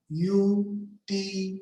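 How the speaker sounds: "Utility" slowly chanted as u-ti-li-ty; split in four parts. Shown as a demonstration. Teacher counting parts of a word, syllable by syllable. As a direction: say "Utility" in four drawn-out separate beats. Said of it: "four parts".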